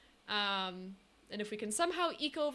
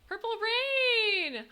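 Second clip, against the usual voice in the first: loud, high, drawn-out name call; excited shout; 12 semitones higher, 8 dB louder, much slower than usual. Real, not acted. Streamer greeting a returning subscriber with an excited shout. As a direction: belt the name out, stretching it.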